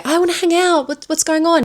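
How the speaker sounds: high-pitched needy burst; suddenly clingy. Retold or imitated. Nikki under the wish begging to hang out, over-eager. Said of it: "have her completely switch and say".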